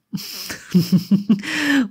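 helles, amüsiertes Auflachen; belustigtes Lachen. A narrator's reading. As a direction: kurz und belustigt lachen. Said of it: "Ein belustigtes Lachen ertönte". (Draco lacht amüsiert über Harrys Geständnis)

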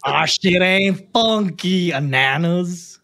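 slow, drawn-out mock Texan accent; hammed-up, unplaceable would-be Texan drawl; badly faked Texan accent, stretching every word. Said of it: "What kind of accent?".

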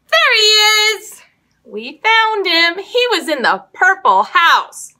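high, loud delighted cry; happy to find the mouse; excited discovery. Real, not acted. Mouse found in the game.

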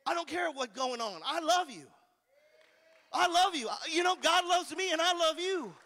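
rising to a loud shouted I love you; loving declaration. Pretend. Pastor voices a believer answering cold stares, building to a shout. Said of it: "be the one that's like".

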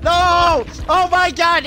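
loud, high dismayed cry; anguished shout. Real, not acted. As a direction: yell no in disbelief. Killer gets four K.

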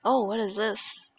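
ordinary delivery

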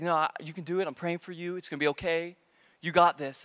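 low, soft pastor pep talk; calm reassurance. Retold or imitated. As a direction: quietly reassure a nervous helper. Pastor reassures the nervous kid.

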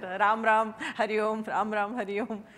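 called out loud and bright; loud chanted greeting to a parrot. Retold or imitated. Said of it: "So I said".

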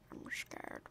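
barely audible whisper; tiny whispered confession; hushed whisper. Pretend. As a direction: whisper it very softly. Hushed while hunting target.

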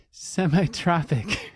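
slow, laughing through the word; giggling as the word comes out. Real, not acted. Host laughs repeating the guest's odd word, laughing.

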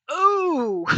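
high, loud startled "Oh!". Retold or imitated. Doctor recognizing the killer, startled high yelp. Doctor realizes it's Speck.

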